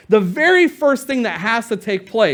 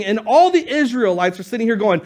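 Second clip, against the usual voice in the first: ordinary talk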